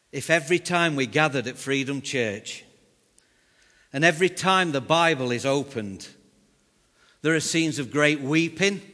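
rising, loud preaching cadence. Acted. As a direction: paint the vision with passion. Preaching the vision.

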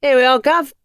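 high, loud cheery cabbie. Acted. Cabbie pulling up at Tom's flats, cheery. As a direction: announce the stop like a London driver. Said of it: "cabbie arrives".

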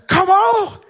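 loud, high beckoning shout; calling out. Retold or imitated. Friend urging him into the water, calling out. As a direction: shout to bring a friend over.

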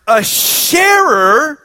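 sharer sostenuto, alto e forte; stress on sharer. Acted. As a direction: allunga e alza "sharer". Stresses key word in sermon.

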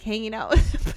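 ordinary talk, nothing marked

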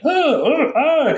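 high mock yells of pain; yelling. Shown as a demonstration. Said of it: "like yelling".

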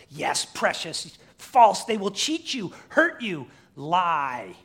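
loud, high creature taunt, building word by word; Gollum. Acted. Preacher as Gollum warning Smeagol of lies, venomous. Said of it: "Gollum says".

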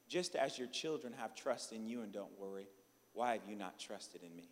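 soft, low voice of the Holy Spirit; gentle divine reproof. Retold or imitated. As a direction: question gently, low and hushed. Voicing the Holy Spirit's lesson.

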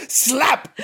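high, gleeful "Slap!"; mock shout. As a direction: squeal "Slap!" high and bright. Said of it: "As he slapped her".

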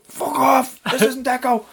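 high put-on voice of a furious stranger; mock furious stranger; angry resident. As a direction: play the annoyed man on the intercom.

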